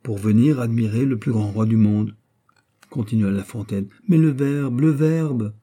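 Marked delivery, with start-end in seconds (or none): 4.1-5.5 higher, pressing repeat of "le verbe"; stubbornly insistent. A narrator's reading. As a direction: keep insisting on "le verbe", obstinately. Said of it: "insista obstinément Pélisson".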